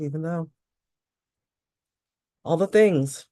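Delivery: repeat-after-me phrases with a sudden exasperated curse; tapping script. Acted. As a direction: guide the tapping, let the frustration burst out.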